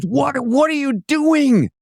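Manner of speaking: high, slow cry of disbelief; retold shocked outcry. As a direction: act out the boss catching you mid-blunder. Phil storms out at smoking car.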